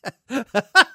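real chuckle rising in pitch; laugh. Real, not acted. Joking about his nice friends, real laugh. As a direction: laugh at your own remark.

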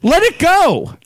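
high, exasperated plea. Real, not acted. Telling accent critics to drop it, exasperated. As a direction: push it up high, fed up. Playful argument over film accent.

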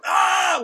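high-pitched open-throated scream; imitated scream. Bandmates making noise in the studio, imitated scream. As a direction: imitate a band screaming wildly. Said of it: "we're just screaming at the top".

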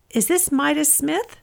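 put-on a light German accent, questioning; strongly accented caller. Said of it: "a strongly accented female voice asked".

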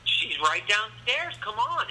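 high, drawn-out imitation of a girl; eager girl. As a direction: urge them along in a mock girly voice.

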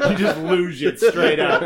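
high laughing words. Real, not acted. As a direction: laugh through the line. Laughing at the cannonball.

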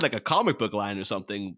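usual speaking voice

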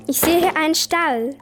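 ordinary talk

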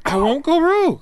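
high-pitched protesting promise; squeaky promise. Pretend. Protesting promise after being scolded.

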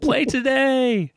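very high, slow mock holler; hammed-up heckle, stretched out high; imitated shouting. Imitating a friend heckling the band, high mock shout. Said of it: "Groove was just shouting".

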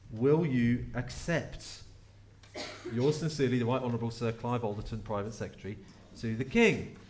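grand official letter voice; formal letter read aloud. Acted. Preacher reads the king's letter to the church, in a formal voice. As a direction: read it out like a royal proclamation.

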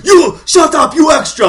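high, loud mock outburst; over-the-top, high-pitched shout; hammed-up movie shout. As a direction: yell it like a corny movie line. Parroting the movie line again.

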